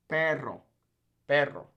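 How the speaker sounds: "Perro" with a trilled rr; rolled R. Shown as a demonstration. Teacher drills double R, rolled R. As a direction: roll the middle of "Perro". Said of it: "You really gotta roll those R's".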